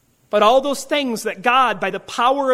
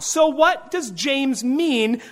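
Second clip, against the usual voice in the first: usual speaking voice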